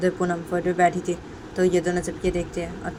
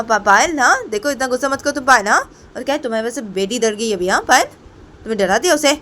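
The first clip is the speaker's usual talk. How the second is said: high, loud, indignant telling-off; angry scolding; 8 semitones higher, 7 dB louder than usual. Acted. Poonam scolds Payal for frightening the child, angry. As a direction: scold hard, pushing the pitch up.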